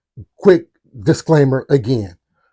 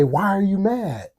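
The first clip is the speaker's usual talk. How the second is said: put-on higher-pitched child's question; imitated little boy; 6 semitones higher, 4 dB quieter than usual. Little boy questioning Gerald on the porch, lightly imitated kid voice. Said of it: "He say".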